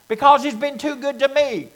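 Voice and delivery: usual speaking voice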